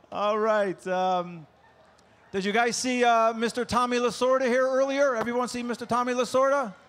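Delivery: usual speaking voice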